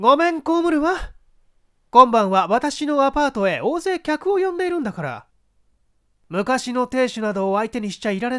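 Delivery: high, teasing, scornful woman; mocking actress. Actress mocking her ex-husband, high and scornful. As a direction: brush him off with scorn.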